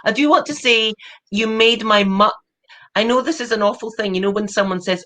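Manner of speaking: ordinary talk, nothing marked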